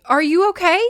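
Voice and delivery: high, loud worried question; narrated anxious cry. A narrator's reading. She sobs in his arms, anxious high question. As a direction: ask urgently, pitched well up. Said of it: "shaking and sobbing".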